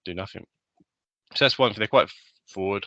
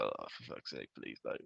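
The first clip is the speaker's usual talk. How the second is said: muttered under breath; 11 semitones lower, 16 dB quieter, slower than usual. Retold or imitated. Inner groan at chatty tourist.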